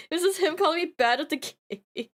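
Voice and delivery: fast, laughing through it. Real, not acted. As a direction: giggle while rushing the words.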